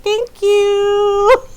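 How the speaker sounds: high, delighted thank you; pleased thanks. Retold or imitated. Wife thanking husband for calling her pretty, high and delighted.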